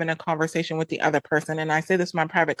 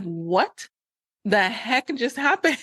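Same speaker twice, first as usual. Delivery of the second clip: retold disbelief; 4 semitones higher, much slower than usual. Retold shock at sudden breakup.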